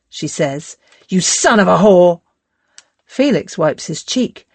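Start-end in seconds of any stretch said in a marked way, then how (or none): 1.1-2.2 louder, spat-out insult; furious curse. Acted. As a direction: hurl it in his face. Spits curse at Felix.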